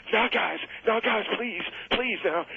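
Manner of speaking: high, pleading cry of a frightened man; pleading scream. Retold or imitated. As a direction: beg them to stop, pitched high and desperate. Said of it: "Tommy Rich is screaming".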